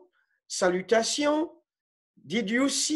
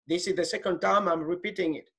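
quick and soft, under the breath; a hurried, softer muttered aside; rushed aside; 4 semitones lower, 4 dB quieter, much faster than usual. Real, not acted. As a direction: toss it off fast to yourself.

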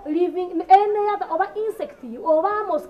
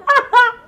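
loud laugh; 8 semitones higher, 9 dB louder than usual. Real, not acted. Mother-in-law bursts out laughing at the new maid. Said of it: "why are you laughing?".

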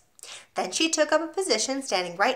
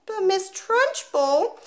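put-on 1 pleading small boy; 11 semitones higher, much slower than usual. Boy protests.

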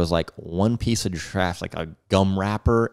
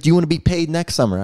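higher, quick pointed question; stern father; 10 semitones higher, faster than usual. Retold or imitated. Father pressing his son about pay, sternly. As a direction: push the kid to the edge.

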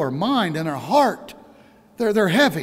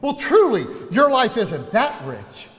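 normal talking voice

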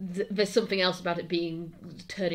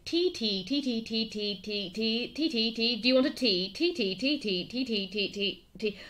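rapid-fire tea offers, slightly raised pitch; trying out repetition; 4 semitones higher than usual. Shown as a demonstration. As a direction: repeat it over and over as a test. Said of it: "also, let's see".

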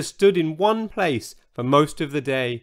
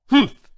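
sharp, loud, high humph; indignant snort; 11 semitones higher, 7 dB louder than usual. A narrator's reading. Old Triceratops dismisses the newcomer, snorting. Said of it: "snorted George".

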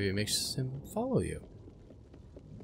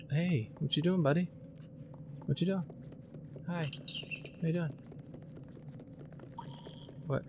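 talking to a pet; 6 semitones higher, 7 dB quieter than usual. Real, not acted. Cooing at a creature.

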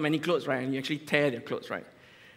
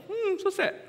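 mock sadness. No one answers his question.

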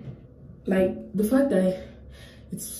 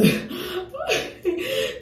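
high laugh; 12 semitones higher than usual. Real, not acted. Laughs recalling punishment.

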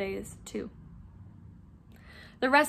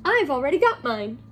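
louder, lively and pleased; Hermione, bright; 3 semitones higher, 5 dB louder than usual. Acted. Hermione reveals she has her hair, brightly. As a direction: chirp it brightly. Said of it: "said Hermione brightly".

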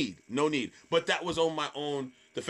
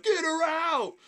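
very high stage holler; rallying the crowd; 4 dB louder, slower than usual. Retold or imitated. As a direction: yell it to win the crowd over.

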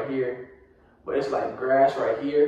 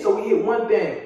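ordinary talk, nothing marked

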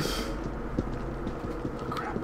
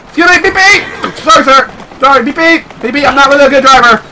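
panicked apology; 10 semitones higher than usual. Real, not acted. Player apologizing to people while driving badly, high and panicked. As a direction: blurt apologies while swerving.

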